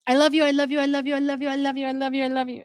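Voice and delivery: mock the needy repetition in her voice; imitating Mackenzie.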